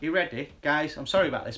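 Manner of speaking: ordinary delivery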